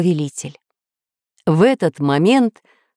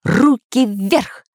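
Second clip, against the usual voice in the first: slow, higher commanding voice; stern command; 4 semitones higher, much slower than usual. A narrator's reading. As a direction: order a villain to surrender. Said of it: "прозвучал голос за его спиной". (отчеканенный приказ)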